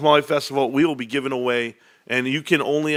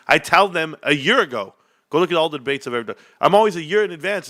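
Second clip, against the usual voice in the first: ordinary talk, nothing marked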